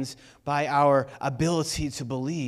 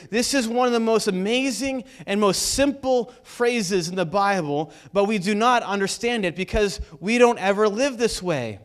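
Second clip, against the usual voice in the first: preaching; 5 semitones higher, 6 dB louder than usual. Acted. Preaching about fearless prisoner.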